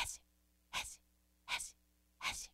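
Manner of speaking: tiny spaced snake hisses; quiet hiss. Acted. Sound creeps up behind Rabbit, hushed hisses. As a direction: hiss softly with gaps between.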